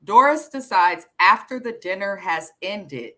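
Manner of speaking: ordinary talk, nothing marked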